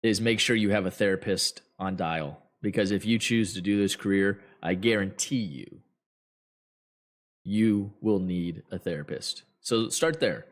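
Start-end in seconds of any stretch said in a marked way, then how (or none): none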